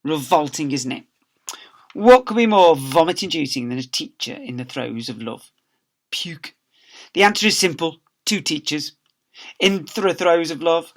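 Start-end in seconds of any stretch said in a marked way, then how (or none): 6.1-6.5 softer whispered aside; conspiratorial whisper; whispered puke. A narrator's reading. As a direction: whisper it in disgust. Disgust aside to listeners.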